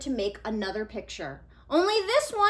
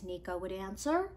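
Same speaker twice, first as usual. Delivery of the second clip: usual speaking voice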